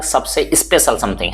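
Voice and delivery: normal talking voice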